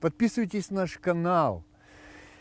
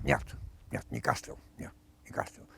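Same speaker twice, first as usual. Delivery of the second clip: put-on old man voice; 3 semitones lower, 9 dB quieter than usual. Old man denies, fading.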